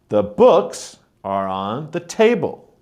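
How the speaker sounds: books and table stressed, the rest light; stress on content words. Shown as a demonstration. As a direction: lean on books and table, let the small words slip by. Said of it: "I'm stressing on the words that are important".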